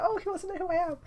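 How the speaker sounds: high, preening show-off voice; smug self-importance. Acted. Lars thinks he's famous, smug. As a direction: act the delighted would-be celebrity. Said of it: "He thinks he's famous. He's like".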